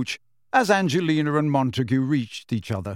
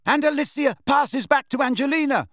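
high, raised commentator shout; 12 semitones higher than usual. A narrator's reading. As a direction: shout over the crowd. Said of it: "Lee shouted".

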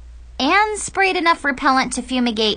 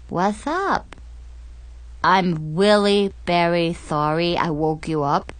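snorkel voice; 2 semitones lower than usual. Acted. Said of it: "with a really wicked lisp".

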